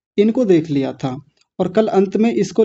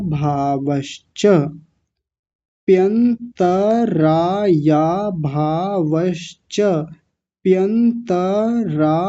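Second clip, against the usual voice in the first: whole sutra said very slowly; slow recitation; much slower than usual. Shown as a demonstration. Teacher recites the sutra slowly for the class. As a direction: chant it slowly, piece by piece. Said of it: "तो क्या बनेगा?".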